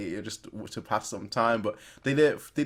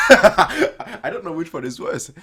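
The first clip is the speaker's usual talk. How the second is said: real laughter breaking into loud, higher words; laughing; 5 semitones higher, 8 dB louder, slower than usual. Real, not acted. Viewer reacting to a cruel joke in the show, laughing. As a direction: say it louder and slower through genuine laughter.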